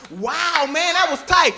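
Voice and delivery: high, excited awe; impressed amazement. Acted. Robber to customer after the backflip, high amazed admiration. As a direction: gush over the trick in a high voice.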